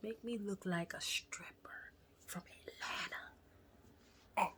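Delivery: slow, conspiratorial whisper; cheeky whisper. Retold or imitated. Patient making a cheeky request to surgeon, slow whisper. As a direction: whisper it slowly like a naughty secret.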